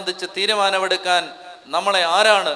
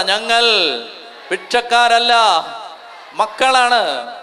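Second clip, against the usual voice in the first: loud call to repeat; 6 dB louder than usual. Shown as a demonstration. Preacher leads the congregation in a loud declaration. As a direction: lead the crowd in a loud declaration. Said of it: "ഞാൻ പറയുന്നത് നിങ്ങൾ ഉറക്കെ പറയണം".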